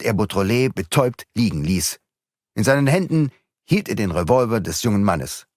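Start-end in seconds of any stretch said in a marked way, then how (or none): none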